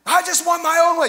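put-on high, loud toddler whine; three-year-old whine. Pastor mimicking his toddler son Titus, high loud whining. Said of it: "You know what you sound like? A three-year-old".

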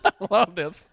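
chuckling through the words with real delight; a genuine amused laugh. Real, not acted. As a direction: laugh as you say it, tickled by the line. Preacher delighting in Peter's words.

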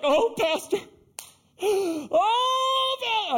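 high, loud hammed-up wail; mock wailing. Woman at the altar to her pastor, imitated loud wailing. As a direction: wail it out like a mock drama queen. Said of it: "I mean, she was wailing".

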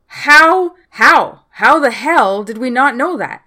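loud, high exclamations of disbelief; shocked disbelief. Real, not acted. Podcaster reacting to a plot twist, loud disbelief. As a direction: demand an answer, stunned and loud.

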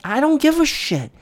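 fed-up exclamation; high frustrated outburst. Real, not acted. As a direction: pitch jumps up in disgust. Ranting about player effort.

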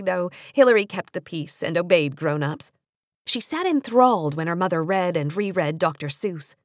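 ordinary delivery

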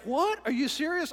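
high, loud startled disbelief; surprised. Retold or imitated. Friend reacts to the pastor's ultimatum surprised. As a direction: jolt up in shock. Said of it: "he's like".

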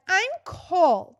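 sneering imitation of a complaining wife; whiny mock Heidi. Mocking Heidi complaining about the cold in a group chat, whiny. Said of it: "Heidi bitching on a group chat where she's like".

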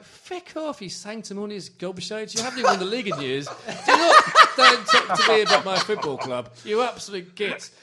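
hammed-up shouting that breaks into laughter; a mock tirade at smug Arsenal fans, loud and laughing; mock furious retort. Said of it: "and it's like".

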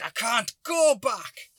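slow, high-pitched protest; re-enacted exasperated yell. Driver answering the stranger's order, re-enacted high protest. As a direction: drag out a high cry of refusal.

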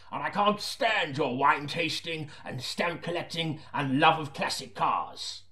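slow, exasperated rant; grumbling chief. Acted. Chief constable complains about Seaton's hobbies. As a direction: list his hobbies with contempt.

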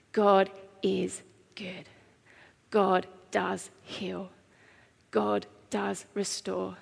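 slow worship declaration. Acted. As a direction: lay each truth down gently, one at a time. Slow soft declarations of faith.

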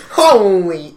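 loud, drawn-out HOLY; exaggerated amazement. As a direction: belt out HOLY as an overblown take. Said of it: "it was more just like a".

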